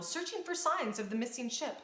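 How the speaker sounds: ordinary delivery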